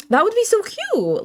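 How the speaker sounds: high, gushing squeal; giddy high-pitched swoon; squealing so cute. Real, not acted. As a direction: gush over the idea of free money. Daydreams about easy video money.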